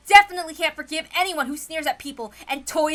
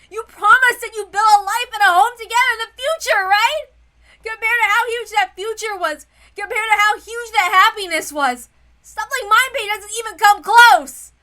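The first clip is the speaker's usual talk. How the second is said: rising, louder and higher plea; impassioned plea; 6 semitones higher, 8 dB louder than usual. Acted. Battler urges his grieving cousin George, impassioned and rising. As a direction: push harder with every phrase.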